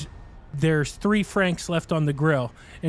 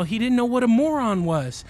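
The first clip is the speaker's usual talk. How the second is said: imitating Charlie Murphy; 7 semitones higher, 4 dB louder than usual. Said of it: "he's all".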